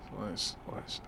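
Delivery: whispered focus. Real, not acted. Creeping out of the depot.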